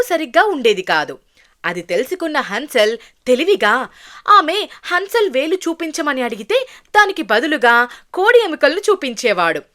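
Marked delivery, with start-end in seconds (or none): none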